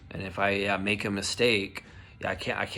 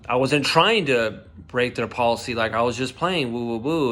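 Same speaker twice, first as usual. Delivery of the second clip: loud, heated protest; reliving anger; 3 semitones higher, 8 dB louder than usual. Retold or imitated. Worker protesting HR accusation, loud and heated. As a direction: relive the indignation. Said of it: "reliving it 'cause I used to do acting".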